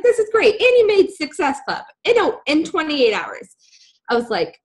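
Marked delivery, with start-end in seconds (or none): 0.0-3.5 high-pitched imitation of an excited friend; thrilled praise. Friend praising her quick success, thrilled. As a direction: gush with praise, pitched right up.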